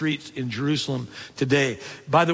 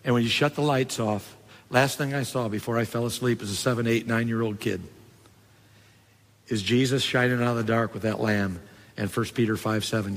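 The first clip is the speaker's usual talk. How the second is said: normal talking voice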